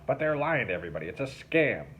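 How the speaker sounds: fast, heated protest; indignant. Acted. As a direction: rush it out angrily.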